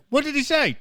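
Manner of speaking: high-pitched puzzled question; mock confusion. Host playing out a taxi mix-up, high mock confusion. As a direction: ask it high, as a baffled passenger.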